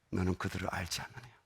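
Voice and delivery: soft, low and calm; gentle voice of God. Retold or imitated. God speaking gently to the student, soft and low. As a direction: say it quietly, like a gentle rebuke. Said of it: "잔잔하게".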